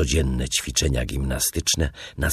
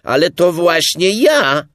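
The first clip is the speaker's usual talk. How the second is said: high, loud, surprised shout; raised-voice astonishment; astonished; 10 dB louder than usual. A narrator's reading. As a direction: protest in amazement, calling out. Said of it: "odpowiedział miś ogromnie zdziwiony".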